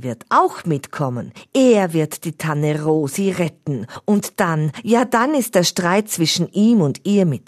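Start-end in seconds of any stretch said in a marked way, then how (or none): none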